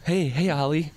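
a hint of a sweet girlfriend; playful girlfriend voice. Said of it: "You, you play me".

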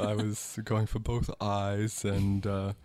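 slow, incredibly measured celebrity impression. Singer apologising to [name] for a kick, slow and measured. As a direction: draw out each phrase with deliberate, measured pauses. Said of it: "he speaks slowly and incredibly measured".

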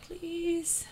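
small, high, soft plea; hushed play-acted begging; mock pleading. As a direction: beg softly in a tiny high voice. Voices the praying hands emoji.

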